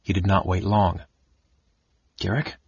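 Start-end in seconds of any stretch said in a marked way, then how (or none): 2.2-2.6 soft, questioning call into the dark; tentative, softer, lifting at the end; hesitant. A narrator's reading. Cousin seeking Garrick in the shadows, hesitant. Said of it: "said a hesitant voice".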